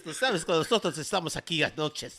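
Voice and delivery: mock Spain Spanish lisp; Castilian Spanish accent. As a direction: lisp it like a Madrid local. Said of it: "With your lisp-sounding ass".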